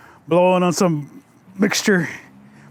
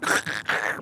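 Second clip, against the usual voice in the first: throaty choking noise; 10 semitones lower, 7 dB quieter than usual. A narrator's reading. Shy young man tries to drink near the girl, choking. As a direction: choke on a drink of water. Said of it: "began to choke".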